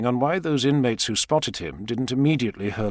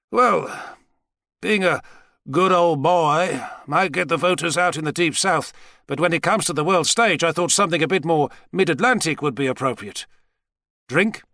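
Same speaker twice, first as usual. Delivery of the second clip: put-on higher-pitched American Southerner putting on a mid-Atlantic accent; Southern good old boy aping a mid-Atlantic accent; 6 semitones higher than usual. President explaining his new accent to Savage, affected mid-Atlantic accent. A redneck impersonating the actor Cary Grant.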